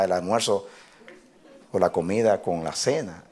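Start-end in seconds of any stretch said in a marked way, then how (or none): none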